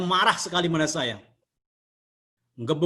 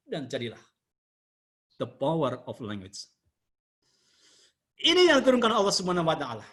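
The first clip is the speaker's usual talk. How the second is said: normal talking voice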